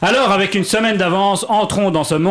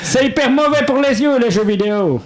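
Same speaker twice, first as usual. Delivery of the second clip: much higher yelling hamster in a mock Russian accent; 8 semitones higher than usual. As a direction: yell it in a hammed-up Russian accent. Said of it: "Pourquoi je prends l'accent russe".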